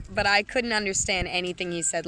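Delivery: ordinary talk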